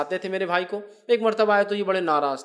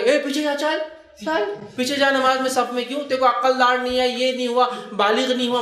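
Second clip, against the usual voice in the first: harsh scolding elder; 5 semitones higher than usual. Retold or imitated. Imitating a masjid elder scolding a boy out of the front row. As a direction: bark at the child to get to the back row. Mocking elders shooing kids away.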